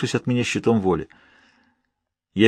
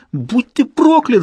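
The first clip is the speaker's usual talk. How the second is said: high, drawn-out screamed curse; yelling; 11 semitones higher, 4 dB louder, much slower than usual. A narrator's reading. Narrator struggling with his corpse, yelling. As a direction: howl the curse with full force. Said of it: "завопил я ещё громче".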